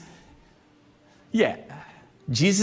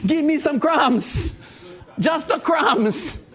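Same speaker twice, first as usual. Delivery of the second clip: begging; 6 semitones higher, much slower than usual. Retold or imitated. Voicing the begging woman.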